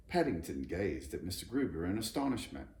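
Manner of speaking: ordinary delivery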